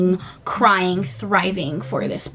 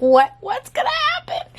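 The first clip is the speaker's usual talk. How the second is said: a much higher, mock-frantic cry of what's gonna happen; mock panicked wail; 13 semitones higher, 3 dB louder than usual. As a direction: squeal it like a fan losing it over the ending.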